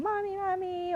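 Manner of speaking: little one calling. Retold or imitated. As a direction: imitate a small kid calling out, high-pitched. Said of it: "my little one was calling".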